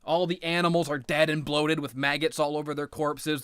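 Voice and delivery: ordinary delivery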